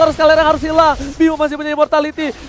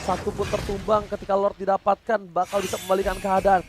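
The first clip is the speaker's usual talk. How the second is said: quiet commentary; 9 semitones lower, 11 dB quieter than usual. Real, not acted. Quiet calm analysis stretch.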